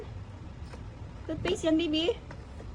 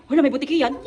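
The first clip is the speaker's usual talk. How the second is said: ordinary talk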